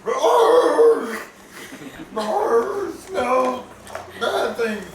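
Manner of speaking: a high, loud, barking roar of a dog. Acted. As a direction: play the dog sniffing out danger. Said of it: "I'm like".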